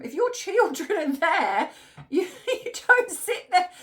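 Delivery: can't help laughing at it. Real, not acted. Mocking the mum's story, laughing.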